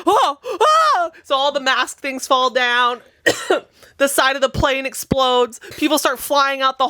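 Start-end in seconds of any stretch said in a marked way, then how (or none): 0.0-1.1 very high, startled passengers; hammed-up panic at the blast; mock alarmed oh oh.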